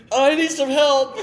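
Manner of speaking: high, loud, slow plea broken by laughter; kid calling for help. Retold or imitated. Injured freshman calling out from the suit, high and laughing. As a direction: wail it out like the stuck kid while you crack up. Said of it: "and he's like".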